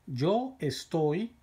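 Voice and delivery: drawn out slowly for learners; slow model reading. Shown as a demonstration. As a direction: say it slowly so students can copy. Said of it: "teacher models the answer".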